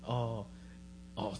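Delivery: usual speaking voice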